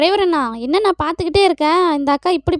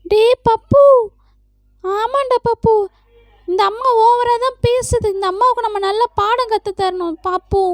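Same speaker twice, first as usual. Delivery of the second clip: put-on high childlike voice, egging a friend on; kid sidekick voice; 7 semitones higher than usual. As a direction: pitch it up like a little boy conspiring. Kid sidekick talks to Pappu. (உயர்ந்த குழந்தைக் குரலில் சதி பேசுதல்)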